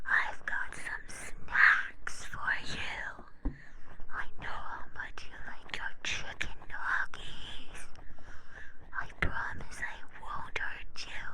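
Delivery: slow, eerie unvoiced whisper; ghost voice. Ghost luring the little gems out with snacks, in an eerie whisper. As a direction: coax them out like a creepy ghost. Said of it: "can I be the ghost again?".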